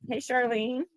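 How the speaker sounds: normal talking voice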